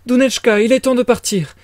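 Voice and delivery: high, flustered urging; frantic mother. A narrator's reading. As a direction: panicky mama hurrying her daughter along. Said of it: "d'un air éperdu".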